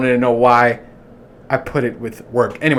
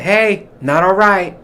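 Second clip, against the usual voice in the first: drawn-out higher call; calling for attention; 5 semitones higher, 5 dB louder, slower than usual. Acted. Signalling coworkers he is not all right, drawn-out call. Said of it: "if I wasn't fucking all right".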